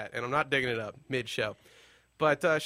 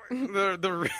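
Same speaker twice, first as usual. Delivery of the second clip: ordinary talk, nothing marked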